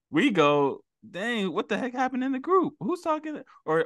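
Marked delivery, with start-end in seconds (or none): 1.1-3.4 surprised. Retold or imitated. Boss reacting to group chat.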